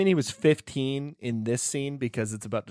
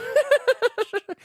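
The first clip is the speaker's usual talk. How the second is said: high squeaky giggle; leprechaun giggle. Retold or imitated. Leprechaun giggle after rhyme.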